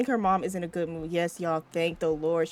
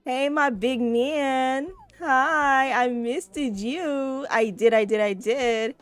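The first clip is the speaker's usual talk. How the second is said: higher, very slow and drawn out cooing hello; doting aunt greeting; 6 semitones higher, 4 dB louder, much slower than usual. Retold or imitated. Aunt greeting her little nephew, slow and cooing. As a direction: stretch every word sweetly like greeting a toddler. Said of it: "You be like".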